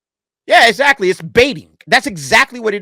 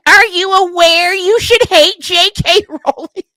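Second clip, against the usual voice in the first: high, slow, prissy mock reporter voice; 12 semitones higher, 5 dB louder, slower than usual. Mimicking the reporter grilling Keira, high and slow. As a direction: sneer it out like a preachy interviewer. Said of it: "that's basically what this reporter sounded like".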